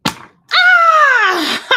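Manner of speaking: loud, high startled yelp breaking into a laugh; sharp, very high "ah"; startled scream. Real, not acted. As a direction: scream at a sudden noise. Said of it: "That was loud".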